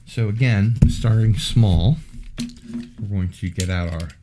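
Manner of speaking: ordinary talk, nothing marked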